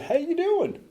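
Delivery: high, far-off cheerful chirp; a breezy falsetto hello at the detox door; chirpy greeting. Retold or imitated. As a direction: chirp it like nothing is wrong. Breezy greeting when drunk arriving.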